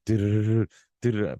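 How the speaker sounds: rapid rolled drum noise with the mouth; imitated double bass drum. Fan showing how the double bass starts, imitated drum roll. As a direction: imitate a burst of fast kick drums. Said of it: "when that double bass starts".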